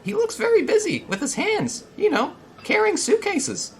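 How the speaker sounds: very high, loud put-on voice; high-pitched, busybody tour guide; Cap. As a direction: politely press someone to help, in falsetto. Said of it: "let's see if I can do his voice".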